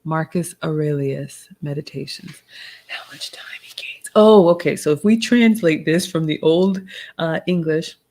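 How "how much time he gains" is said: whispered echo of the quote. Shown as a demonstration. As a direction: breathe the quote back, voiceless.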